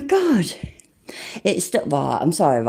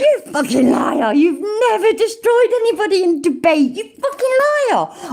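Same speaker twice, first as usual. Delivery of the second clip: furious shout; 13 semitones higher than usual. Real, not acted. Yelling at streamer's false claim.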